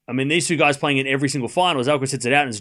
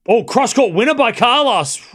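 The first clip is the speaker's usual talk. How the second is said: higher and louder, slowed exclaiming; excited call; 9 semitones higher, 6 dB louder than usual. Real, not acted. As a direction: marvel at the winner. Winner hit, commentator exclaims.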